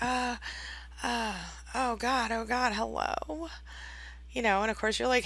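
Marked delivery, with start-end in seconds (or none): 0.0-3.5 reluctant mock phone answer, drawn-out hello; begrudging. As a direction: answer the phone as if it's a huge imposition. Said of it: "begrudgingly, the narcissist picks up the phone".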